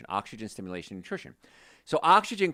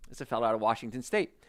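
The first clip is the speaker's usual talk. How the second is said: ordinary delivery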